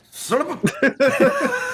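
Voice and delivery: slow, drawn-out high exasperated cry; frustrated curse. Real, not acted. Game master tripping over names, exasperated cry. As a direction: let the frustration spill out, stretched. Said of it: "Tongue twisters for sure".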